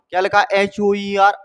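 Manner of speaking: ordinary talk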